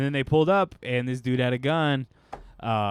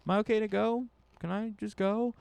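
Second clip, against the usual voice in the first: nervous guy waving at tinted windows; timid asking; 9 semitones higher than usual. Retold or imitated. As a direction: ask like you're scared of the cops. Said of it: "waving, like".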